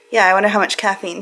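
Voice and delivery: normal talking voice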